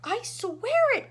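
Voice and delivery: high, loud, slow pleading cry; loud earnest protest; cried. A narrator's reading. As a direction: insist desperately. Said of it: "cried poor Shuckworth".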